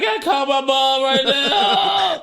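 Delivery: loud, high mock wail, drawn out; drunken outburst. Drunk brother demanding to call Mom, high drawn-out yell. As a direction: imitate a drunk suddenly yelling in desperation.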